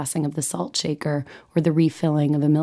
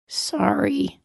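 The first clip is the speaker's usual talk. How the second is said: tiny apology; 10 semitones higher than usual. Retold or imitated. Sulking daughter giving a tiny, high apology. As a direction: shrink it to a tiny high peep. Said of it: "contracted into the world's smallest living".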